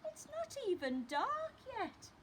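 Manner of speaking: put-on high grumbling child; protesting child Lester. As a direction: complain like a boy sent to bed early. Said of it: "said Lester".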